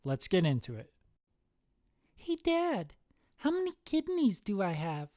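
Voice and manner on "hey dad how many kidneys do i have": put-on high-pitched small child's question; little kid. Son asks dad about kidneys, high kid voice. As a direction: pipe up like a curious kid.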